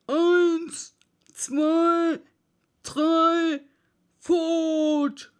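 loud, high race countdown; starting call. Acted.

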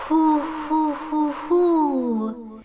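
a touch of spooky hoo-hoo; ghostly hooting. A narrator's reading. Little ghost hooting again, lightly spooky. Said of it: "macht es da erneut".

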